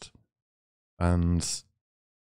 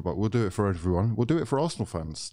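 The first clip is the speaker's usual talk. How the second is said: normal talking voice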